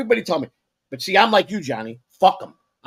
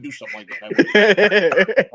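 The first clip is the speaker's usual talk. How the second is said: laughing; much slower than usual. Real, not acted.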